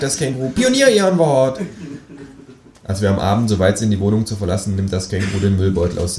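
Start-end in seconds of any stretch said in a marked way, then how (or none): 0.5-1.6 clipped kangaroo demand. Acted. (hohe Känguru-Stimme)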